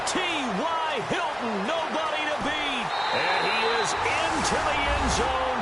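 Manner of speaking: excited football announcer belting out the scorer's name; thrilled play-by-play. Acted. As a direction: pitch leaps up, long stretched vowels. Calls a Hilton touchdown.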